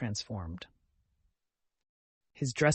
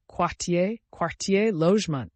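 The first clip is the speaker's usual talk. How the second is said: condescending; 5 semitones higher, 5 dB louder than usual. A narrator's reading. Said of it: "condescending and good-natured smile".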